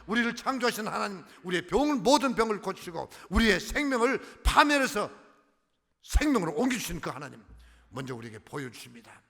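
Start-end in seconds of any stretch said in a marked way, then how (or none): 0.1-7.4 forceful preaching. Real, not acted. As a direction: proclaim it to the congregation. Preaching build.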